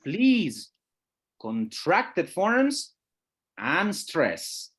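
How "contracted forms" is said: usual speaking voice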